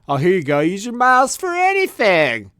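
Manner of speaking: a hint of a chirpy salesman; mock airline pitch. Mocking the airline's miles pitch.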